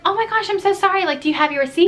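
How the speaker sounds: very high, gushing apology; apologetic customer service. Retold or imitated. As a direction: imitate an eager, apologetic server up high. Acted apology to complaining customer.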